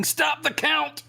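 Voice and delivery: high, drawn-out mock scream. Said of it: "right now he's screaming".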